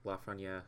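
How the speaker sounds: "Lafreniere" said the French way, ending in a soft "yeah"; French pronunciation. Real, not acted. Co-host naming next year's prospect, French pronunciation of "Lafreniere". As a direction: say "Lafreniere" French-style, final r dropped. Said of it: "I say it the French way".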